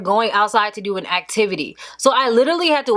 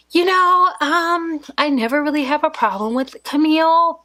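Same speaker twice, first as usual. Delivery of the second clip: high, sweet teacher voice put on for the mom; sugary fake-nice teacher; 6 semitones higher than usual. Teacher to the mom, suddenly higher and sweet as pie. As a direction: sweeten it up like a teacher faking politeness. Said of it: "changes her whole demeanor".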